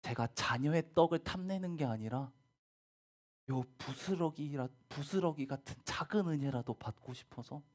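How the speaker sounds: gently acted, earnest plea; earnest pleading. Woman asking Jesus for small grace, earnest pleading. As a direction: beg humbly with mild urgency. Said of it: "간절히 찾은 거예요".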